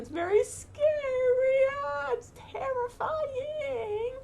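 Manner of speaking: high, slow, wailing mock fright; mock terror. Acting out terror in the dark haunted house, mock wailing. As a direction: ham up being terrified in the dark.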